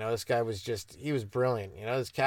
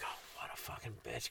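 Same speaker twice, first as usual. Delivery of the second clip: under-the-breath whisper; whispered aside; 3 semitones lower, 19 dB quieter than usual. Retold or imitated. Kid cursing the snitch to himself in a whisper. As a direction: mutter it to yourself, barely voiced. Retold inner thought.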